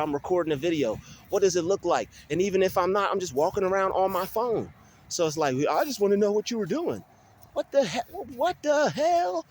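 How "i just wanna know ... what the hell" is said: high mimicking voice, climbing higher; mock incredulity. Vlogger imitating a nosy stranger, high mock incredulous voice. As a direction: mimic him high, then squeal in disbelief.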